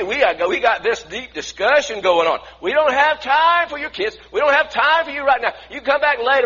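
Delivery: loud, high, impatient brushing-off; disciples shouting. Acted. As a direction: shoo the parents away, loud and bossy. Disciples shooing parents away.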